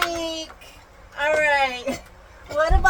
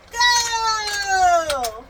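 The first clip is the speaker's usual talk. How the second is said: race start call; 4 dB louder than usual. Real, not acted. Mother starting the toy car race, calling out. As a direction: hold the word as the cars set off.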